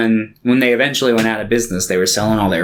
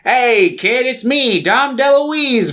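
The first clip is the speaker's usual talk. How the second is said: a hammed-up "hey kid" puppet voice; Dom DeLuise voice; 12 semitones higher, much slower than usual. Imitating the Munchie voice to the co-host. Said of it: "when he's trying to do his".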